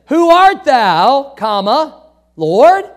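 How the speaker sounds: slow, loud, with comma and question mark marked; punctuated reading; reading punctuation out. Shown as a demonstration. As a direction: read it like scripture with each stop heard. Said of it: "comma ... Question mark".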